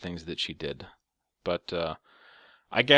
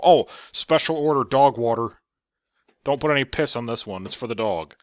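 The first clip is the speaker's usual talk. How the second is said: louder sarcastic mock worker voice; mock fast-food worker; 2 semitones higher, 8 dB louder than usual. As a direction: sneer it as an imagined cashier. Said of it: "Are they gonna go".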